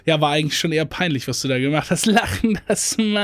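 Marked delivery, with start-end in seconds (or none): 2.1-3.2 mocking emoji tag. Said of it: "die das hinter jeden Satz machen". (spöttisch gedehnter Singsang)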